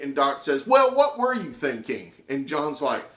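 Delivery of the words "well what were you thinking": high, loud angry question; angry demand. Retold or imitated. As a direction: snap the question at him. Teacher berating student.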